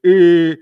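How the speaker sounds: loud mocking nasal grunt; mock soy boy grunt. Mocking liberals' closed-eye face, loud scornful grunt. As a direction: blurt out a scornful eh. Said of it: "soy boy face where they're like".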